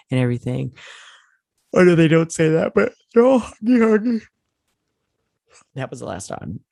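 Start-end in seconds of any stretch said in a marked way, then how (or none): 1.7-4.2 gushing squeal. Real, not acted. Gushing over the hug.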